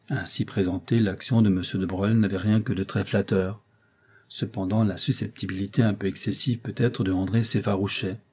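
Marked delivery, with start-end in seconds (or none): none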